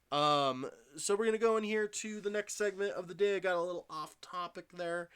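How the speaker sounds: loud, bright host voice; energetic reset. Real, not acted. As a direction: pump up the next segment. Energetic segment transition.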